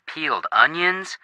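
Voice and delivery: ordinary delivery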